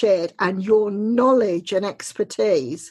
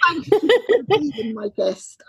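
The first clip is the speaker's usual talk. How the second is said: laughing; 8 semitones higher than usual. Real, not acted. Laughing through her thanks.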